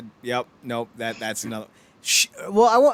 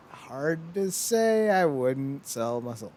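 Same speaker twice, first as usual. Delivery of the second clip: slow musing; much slower than usual. Retold or imitated. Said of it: "I was like".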